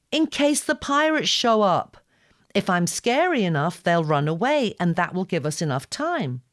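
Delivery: high, loud and determined; earnest explanation. Acted. Girl telling the boy how she'll scare off pirates, high and loud. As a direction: explain the plan with fierce conviction.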